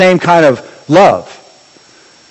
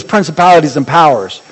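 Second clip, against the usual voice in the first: ordinary delivery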